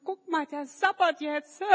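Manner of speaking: put-on children sneering at the drooling boy; mocking kids. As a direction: point and taunt. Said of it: "die Kinder lachen".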